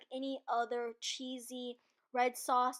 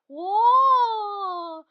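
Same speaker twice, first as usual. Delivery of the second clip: a long, stretched, much louder and higher whoa; drawn-out whoa; 7 semitones higher, 13 dB louder than usual. Real, not acted. Watching her blurry footage, a long amazed whoa. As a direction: let the whoa hang in amazement. Amazed at the weird camera shot.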